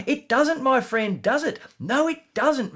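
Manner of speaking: high, sing-song "It doesn't, does it?"; gloating rhetorical jab; mocking triumph. Triumphant mocking debate rebuttal.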